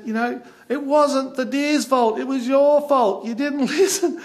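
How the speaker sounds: high, loud retold scolding; emphatic telling-off. Photographer telling his friend the deer was not to blame, emphatic scolding. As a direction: drive the blame home, half amused.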